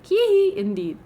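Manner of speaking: squeaky hee hee indeed; high giggle. Acted.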